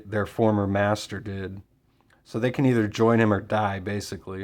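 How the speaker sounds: normal talking voice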